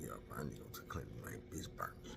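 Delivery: fast urgent whisper about being burned; hushed, hurried whisper to his homeboy; whispered confession. Retold or imitated. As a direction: whisper it quickly so nobody hears. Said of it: "I'm like".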